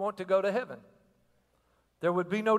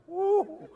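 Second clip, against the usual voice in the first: mock woo; 13 semitones higher than usual. Imitating happy South Georgia crowd.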